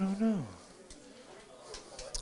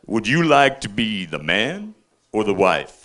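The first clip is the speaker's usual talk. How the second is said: cellie's voice; 7 semitones lower, slower than usual. Acted. Prison cellie voiced in joke.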